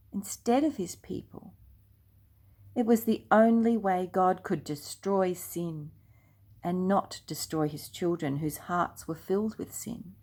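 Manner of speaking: usual speaking voice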